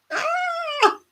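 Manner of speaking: high, shrieking laugh; burst of laughter. Real, not acted. Wife cracks up at husband's beetroot slip, high squealing laugh. Laughing at her own story.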